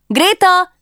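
insistent second call. Acted. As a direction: shout her name the second time. Calling again, louder.

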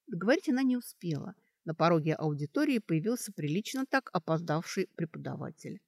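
usual speaking voice

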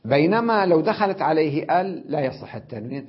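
ordinary talk, nothing marked

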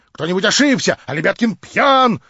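громче, возбуждённо; выкрикнуть в смятении; в волнении. Acted. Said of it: "восклицал инженер в невыразимом волнении". (инженер в невыразимом волнении)

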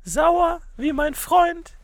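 airy musical character. Pretend. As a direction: float it out as the air.

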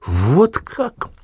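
high sharp mocking exclamation; mock surprise. Officer reacts to insult. (насмешливое удивление)

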